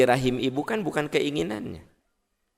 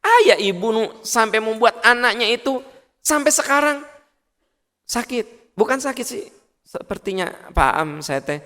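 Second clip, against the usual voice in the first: ordinary delivery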